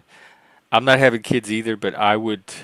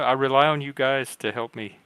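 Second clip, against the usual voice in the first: normal talking voice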